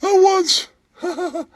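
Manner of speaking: high, squeaky put-on character voice, with giggles; squeaky comic voice. Comic sidekick telling a bar story in a squeaky giggling voice. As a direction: play a giggling oddball sidekick.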